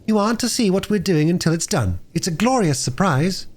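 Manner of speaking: put-on a light girlish voice, gleeful; excited little girl. A narrator's reading. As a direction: play the eager younger sister keeping a surprise. Phyllis voiced in story.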